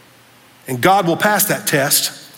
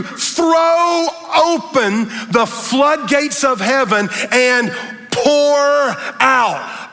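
slow, high, drawn-out preacher's cry; preaching crescendo; 5 semitones higher, much slower than usual. Acted. Pastor reading God's promise to the church, soaring. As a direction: stretch every word like a sermon peak. Quoting Malachi at full force.

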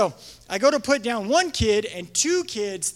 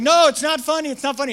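raised, higher insistent no; half-joking protest; 5 semitones higher, 5 dB louder, faster than usual. Retold or imitated. Leader running from laughing kids, raised protest. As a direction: shout back while running from the kids. Fleeing kids across field.

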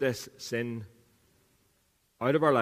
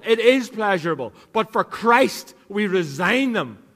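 higher and louder, full preaching force; fervent preaching; 6 semitones higher, 6 dB louder than usual. Acted. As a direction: drive the point home from the pulpit.

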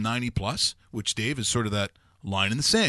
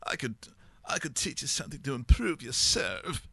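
Mark Holmes, Austin Powers-like; 3 semitones higher than usual. Retold or imitated. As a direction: imitate a swinging Austin Powers British accent. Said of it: "Austin Powers-like. It's, it's, it's weird".

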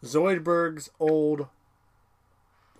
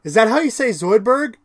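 higher, louder clueless question; naive Fry; 7 semitones higher, 8 dB louder than usual. Retold or imitated. Fry asking about the name, higher naive question. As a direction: ask it like a dim surprise. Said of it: "Fry's like".

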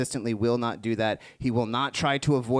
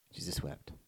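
a breathless whisper, lower and much softer; 6 semitones lower, 13 dB quieter than usual. Acted. Preacher repeating the verse to a hushed room, whispered. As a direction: barely breathe the words out.

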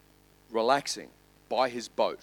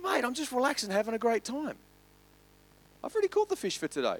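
higher, easygoing bloke voice; lightly voiced relaxed fisherman; 10 semitones higher, faster than usual. Fisherman Trev tells the businessman he's done fishing, light and relaxed. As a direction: sound like you've nothing to worry about.

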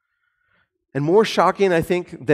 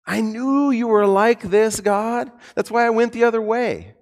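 accusing whine; 6 semitones higher than usual. Retold or imitated. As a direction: blame God like a sulking child. Jonah accusing God.

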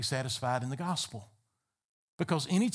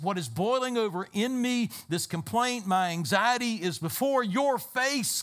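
higher, louder impassioned preaching; full-force preaching; 6 semitones higher, 3 dB louder than usual. Real, not acted. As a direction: press it before the face of God.

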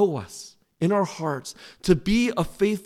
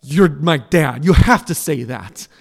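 retold crying child; 5 semitones lower, 6 dB louder than usual. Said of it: "as they're crying, they're like".

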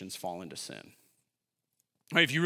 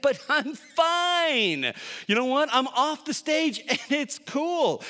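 high, loud, carefree boasting with a laugh; reckless bravado; 10 semitones higher, 8 dB louder than usual. Acted. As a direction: play the proud rule-breaker shouting he's fine. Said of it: "We have this sense that".

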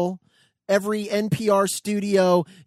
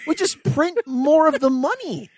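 mock befuddled, higher; 6 semitones higher, 4 dB louder than usual. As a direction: play dumb and puzzled. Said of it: "she's very kind of befuddled".

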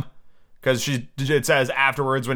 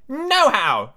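high, emphatic Tweedledum; brisk, high-pitched character exclamation; brisk cry; 11 semitones higher, 3 dB louder than usual. A narrator's reading. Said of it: "Tweedledum cried briskly".

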